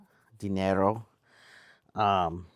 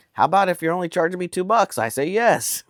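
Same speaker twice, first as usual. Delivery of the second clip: fast, high and a bit louder, playfully eager; retold own reply; 9 semitones higher, 5 dB louder than usual. Retelling his eager answer to the two-dollar offer, fast and high. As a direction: snap up the deal in a rush. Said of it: "And I'm like".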